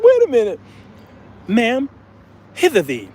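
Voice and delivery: high, hammed-up old-fashioned call; mock posh summons. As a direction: summon the waitress like a lord. Calling the waitress, mock old English.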